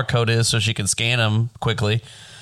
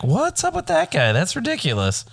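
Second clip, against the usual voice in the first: incredulous; 12 semitones higher than usual. Retold or imitated. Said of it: "I'm like".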